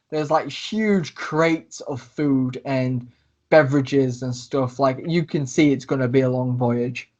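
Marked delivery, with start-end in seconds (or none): none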